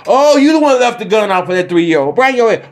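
high, eager executioner taunt; mock executioner. Playing executioner taunting careless gun owner, pitched high. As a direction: gloat at the guilty one.

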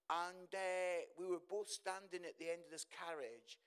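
normal talking voice

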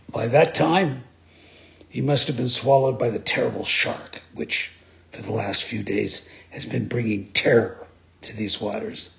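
low, grave sea creature; dolphin voice. Acted. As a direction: tell it darkly in a low voice. Dolphin describes the shark.

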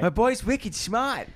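put-on Boston accent. Teasing a cohost in a Boston accent. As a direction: lay on the Boston vowels, slowly. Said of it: "but with a Boston accent".

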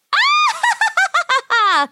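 very high, rattling evil cackle; long shrieking ha-ha-ha laugh; witch cackle. Acted. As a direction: cackle high, falling off at the end. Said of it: "The witch laughed again".

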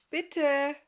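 high, loud, polite plea; called-out plea. A narrator's reading. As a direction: call it out imploringly.

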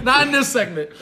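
loud, high, laughing refusal. Real, not acted. Steering friends back to the story, laughing. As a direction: push the topic aside through a laugh.

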